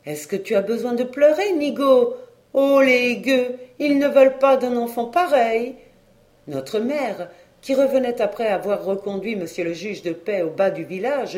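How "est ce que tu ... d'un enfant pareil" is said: high, loud and indignant. A narrator's reading. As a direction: scold warmly, then exclaim in outrage. Indignant woman scolds and consoles boy. (indignée, voix haute et forte)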